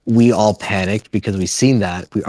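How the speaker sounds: ordinary delivery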